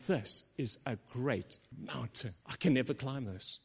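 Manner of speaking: Zerubbabel, beaten, softly; discouraged sigh. Retold or imitated. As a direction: say it looking up in despair. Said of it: "he's going".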